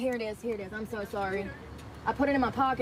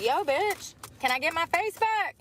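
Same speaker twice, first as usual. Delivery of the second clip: mock sassy retort, higher; sassy comeback; 7 semitones higher than usual. Imagining a drive-thru camera in her face, mock sassy high retort. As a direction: pretend to tell off a drive-thru worker. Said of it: "I'd be like".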